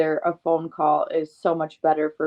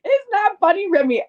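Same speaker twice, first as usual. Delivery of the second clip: laughing high protest; 5 dB louder than usual. Real, not acted.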